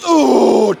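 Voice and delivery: high loud effort yelp on the jump; effort whoop. Real, not acted. Athlete jumping for the record, a high effort whoop. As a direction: let it burst out as you leap.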